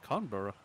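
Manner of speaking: Canberra said as KAHN-buh-ruh, open 'ah' first; guessed pronunciation. Pretend. Player guessing at a trick town name, KAHN-buh-ruh pronunciation.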